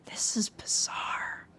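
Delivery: hushed, slow whisper to herself. Real, not acted. Puzzled by the game, slow whisper to herself. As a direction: breathe it out under her breath, slowly. Puzzled aside while playing.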